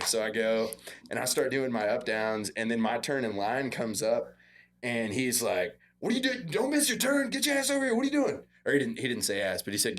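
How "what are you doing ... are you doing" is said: much higher, urgent coach voice; coach barking orders. Retold or imitated. Coach catches player missing his turn in line, high-pitched scolding.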